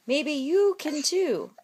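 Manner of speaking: ordinary delivery, nothing marked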